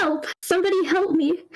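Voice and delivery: high desperate scream for help. Acted. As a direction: yell it out as the crushed man. Narrator voices screaming man.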